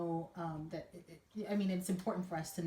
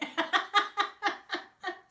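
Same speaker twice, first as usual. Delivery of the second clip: high, loud laughing fit; burst of laughter; 12 semitones higher, 6 dB louder than usual. Real, not acted. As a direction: laugh out hard. Laughs at the baptism mix-up.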